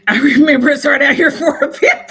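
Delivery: cracking up at her own joke; laughing. Real, not acted.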